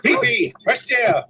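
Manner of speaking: high call to a small dog. Acted. French ambassador calling his dog to heel, high. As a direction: sing the name out high, coaxing.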